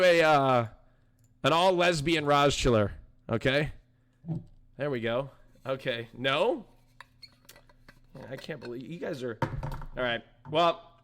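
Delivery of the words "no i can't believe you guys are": ordinary delivery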